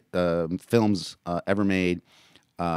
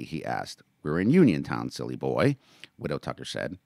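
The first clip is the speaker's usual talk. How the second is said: lively read-aloud of a novel; story narration; 2 semitones higher, 4 dB louder than usual. A narrator's reading. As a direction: narrate the chapter with voiced characters. Reads his novel aloud.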